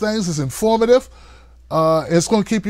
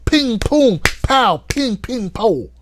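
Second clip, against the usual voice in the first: Kevin Hart impression; 4 semitones higher, much slower than usual. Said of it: "That was my best Kevin Hart impersonation".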